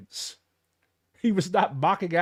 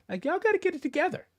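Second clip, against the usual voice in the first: high, fast scolding; quick high exasperated plea; 13 semitones higher, faster than usual. Real, not acted. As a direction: rattle it off high in disbelief. Scolding commenters.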